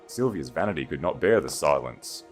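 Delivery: ordinary delivery, nothing marked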